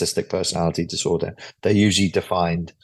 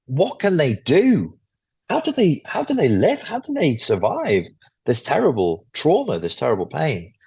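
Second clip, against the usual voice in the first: high, pleading rising questions; anguished questions; 6 semitones higher than usual. Real, not acted. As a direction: ask it as if in pain, voice climbing high.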